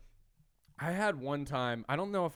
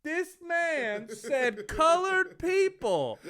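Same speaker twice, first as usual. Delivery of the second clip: high, loud, drawn-out disbelief; mock outrage; 10 dB louder, much slower than usual. Front-row man calls out the comic's words, loud mock outrage. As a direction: imitate a scandalised heckler, stretching each word. Shocked audience member retold.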